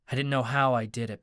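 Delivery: plain reading voice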